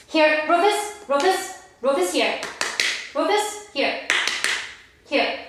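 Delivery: high, bright call of the dog's name; calling the puppy. Real, not acted. Calling the puppy to come back, high coaxing call. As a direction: coax him over to you.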